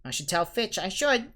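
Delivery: mock-pious, rising high; sly poltergeist feigning goodness; saintly voice. A narrator's reading. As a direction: pretend to be virtuous. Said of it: "said Peeves in a saintly voice".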